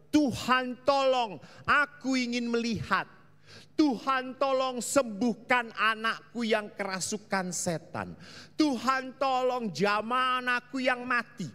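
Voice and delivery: high, loud pleading cry to the Lord; pleading prayer. Retold or imitated. Sick people begging Jesus, loud high pleading. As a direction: beg the Lord for help.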